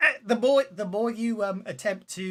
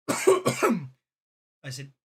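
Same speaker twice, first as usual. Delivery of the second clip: usual speaking voice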